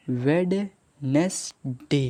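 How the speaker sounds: वेडनेसडे drawn out slowly, वेड नेस डे spelled as said; syllable by syllable. Shown as a demonstration. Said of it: "ना पे ए की मात्रा एस". (वेडनेसडे को धीरे-धीरे वेड-नेस-डे बोलना)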